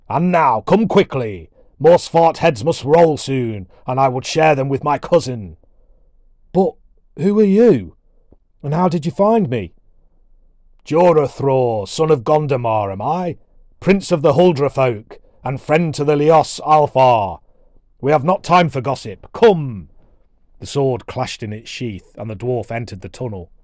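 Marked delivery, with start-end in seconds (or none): 0.1-5.6 loud, raised, booming dwarf voice; big commanding storybook dwarf; gruff dwarf warrior. Acted. 6.5-9.7 high, loud, startled questioning; bewildered boy. Acted. Bewildered boy questions his rescuer. 10.8-19.9 loud, raised, declaiming dwarf voice; booming, commanding warrior; proud dwarf prince. Acted.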